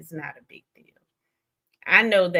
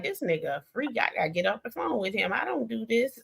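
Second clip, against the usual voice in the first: mock private aside; 7 dB quieter, faster than usual. Imagining backing out of a freaky call, mock-bashful softer aside. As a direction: play embarrassed under your breath, amused. Acts out bashful reaction to caller.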